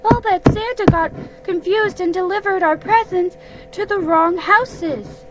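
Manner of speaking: a tiny pig sharing his big idea; Piglet's theory about Santa, high and slowing; excited Piglet. A narrator's reading. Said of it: "said Piglet".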